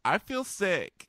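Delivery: high, slow, drawn-out sick whine; mock queasy whine. Bachelor telling her he feels sick, high slow mock whine. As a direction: moan it like you might throw up. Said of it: "And he goes".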